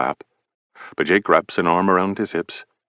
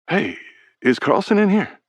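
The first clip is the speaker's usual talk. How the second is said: higher, lighter teammate voice; young teammate Brady; 12 semitones higher than usual. A narrator's reading. Teammate Brady asks for Carlson, light and high. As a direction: pop your head in casually.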